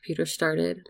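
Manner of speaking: plain reading voice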